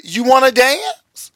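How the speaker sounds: high, slow put-on woman's voice; imitated woman's offer. Woman offers pastor a dance, high slow imitation. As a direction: draw the question out way up high.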